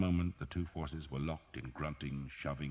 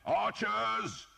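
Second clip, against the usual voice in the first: high, loud summons; shout; 5 dB louder than usual. A narrator's reading. Earl calling his archers, shouted. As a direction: call the archers across the field. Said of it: "the Earl shouted".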